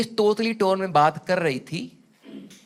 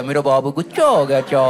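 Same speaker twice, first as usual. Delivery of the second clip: put-on baby talk; 8 semitones lower than usual. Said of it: "वो कैसे बनाती है".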